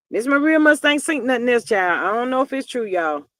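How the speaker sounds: usual speaking voice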